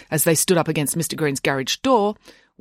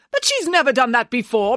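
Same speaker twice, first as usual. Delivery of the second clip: high-pitched, insistent objection; protesting; 7 semitones higher, 3 dB louder than usual. A narrator's reading. Nanny arguing with the boy, protesting. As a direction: object in disbelief. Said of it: "protested Nanny Piggins".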